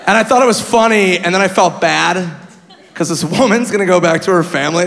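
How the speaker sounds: ordinary talk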